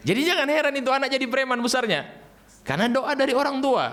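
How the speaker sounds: high, animated warning; lively preacher's warning; excited emphasis. Real, not acted. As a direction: push the point up high to the crowd. Animated sermon conclusion.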